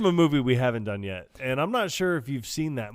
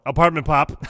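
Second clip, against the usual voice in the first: loud punchline; 5 semitones higher, 9 dB louder than usual. Retold or imitated. Said to police officer.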